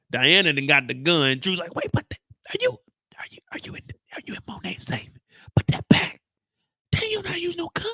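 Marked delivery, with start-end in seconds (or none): 1.7-7.9 high hushed whisper; whisper, panicked. Retold or imitated. Drew whispering at Diana not to use the gun.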